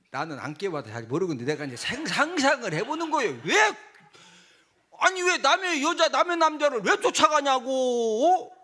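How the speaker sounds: ordinary delivery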